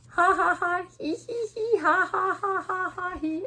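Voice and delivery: high pretend ha-has and hee-hees; two tickled characters laughing, read out loud; giddy, sing-out storybook laughing; acted laughter. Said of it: "And this is like".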